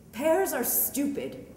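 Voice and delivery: usual speaking voice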